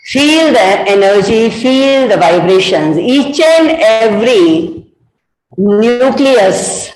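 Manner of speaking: calm meditation guide, very slow and low; guided meditation. Acted. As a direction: ease them into stillness, word by slow word.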